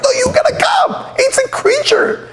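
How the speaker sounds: high-pitched mock shriek; hammed-up frantic plea, squealed high; panicked wife. Wife begging her husband to come home, mock panicked shriek.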